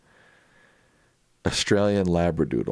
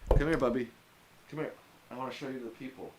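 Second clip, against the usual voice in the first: cooing at the dog; 7 semitones higher, 9 dB quieter, faster than usual. Real, not acted. Coaxing the dog to camera.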